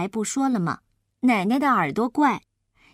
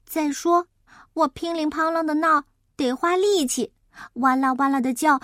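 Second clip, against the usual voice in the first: put-on higher-pitched kid reasoning aloud; thoughtful child; 4 semitones higher than usual. Child thinks kindly of grandma while eating, high and earnest.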